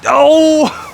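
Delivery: high, loud burst of dismay; excited yelp. Real, not acted. Watching his putt miss the chains, yelping. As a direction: cry out as it lips out.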